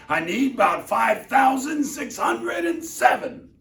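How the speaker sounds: high, loud, defiant boasting; outraged king. Acted. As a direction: refuse to be outdone. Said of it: "snorted Yertle".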